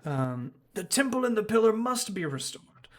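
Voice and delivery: high, anxious pleading priest; meek fretting priest. Acted. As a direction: fret like a timid old priest. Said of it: "the priest there says that".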